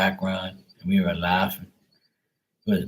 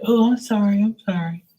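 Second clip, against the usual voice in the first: very high mock apology; apologetic woman; 13 semitones higher than usual. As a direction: imitate a woman squeaking out an apology. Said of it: "She was like".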